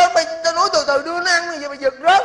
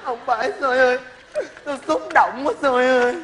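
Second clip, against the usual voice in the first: khóc lóc làm quá cho hài; nức nở kể lể vì cảm động; khóc mếu xúc động. Acted. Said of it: "mày khóc âm thanh hay vậy". (giọng mếu máo, khóc hài hước)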